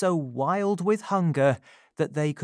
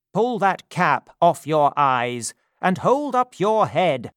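pompous beadle; 5 semitones higher, 7 dB louder than usual. Acted. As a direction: order the boy about. Bumble commands the boy.

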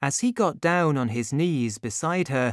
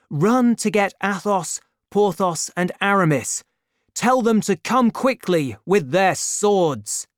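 urgent command; 6 semitones higher, 5 dB louder than usual. A narrator's reading. Urgent orders to servant.

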